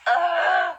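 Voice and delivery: sudden sharp hiccup, high and loud; a real hiccup bursting out. Real, not acted. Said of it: "I have the hiccups".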